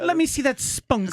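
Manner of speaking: high put-on British voice; a friend mocking British dirty talk, high put-on voice. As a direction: ham up a posh Brit talking dirty.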